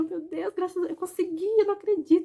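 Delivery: overwhelmed mother crying with relief; retelling her tearful relief at leaving the hospital; retold tearful relief. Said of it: "eu comecei a chorar".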